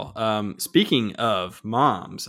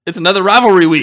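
hyped announcement; 8 semitones higher, 12 dB louder than usual. Real, not acted. Hyping the rivalry matchup.

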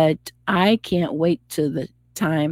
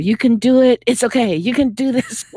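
upbeat self pep talk, a bit higher; cheerleader; 7 semitones higher, 4 dB louder than usual. Retold or imitated. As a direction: cheer yourself on. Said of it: "sound like, um, my own cheerleader".

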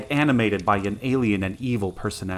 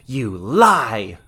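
loud, venomous retort; loud snarl from a wicked twin; spat accusation; 6 dB louder than usual. A narrator's reading. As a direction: spit it out as an accusation. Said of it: "The accusation was spat".

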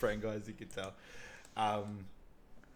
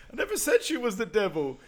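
high mock protest; 11 semitones higher, 5 dB louder than usual. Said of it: "snaps back to the actual guys".

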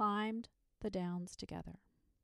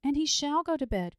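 high, loud, emphatic exclamation; crying out; 8 semitones higher, 10 dB louder than usual. A narrator's reading. Dragon starting up after the saint's rebuke, crying out. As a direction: cry it out with sudden resolve. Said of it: "cried the dragon".

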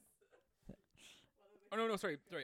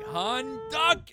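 yelled warning, higher and louder; shouting warning; 16 dB louder than usual. Acted. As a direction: shout at him to duck. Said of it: "I'm like".

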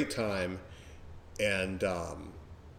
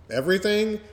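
mock disbelief; 12 semitones higher, 4 dB louder than usual. Shown as a demonstration.

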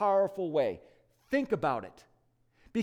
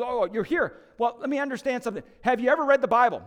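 questioning supervising angel; 5 semitones higher, 5 dB louder, faster than usual. Retold or imitated. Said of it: "the supervising angel comes along and says".

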